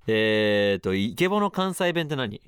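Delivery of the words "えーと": usual speaking voice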